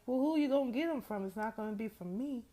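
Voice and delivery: quick, high, cheeky comeback; sassy retort. Retold or imitated. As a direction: toss it back fast and teasing.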